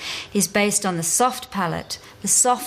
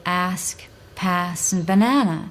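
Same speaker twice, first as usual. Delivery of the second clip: American accent, with "pass" said "pæs" and a flat "a" sound. Shown as a demonstration. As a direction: give "ask", "pass" and "banana" American "æ" vowels. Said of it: "An American would say".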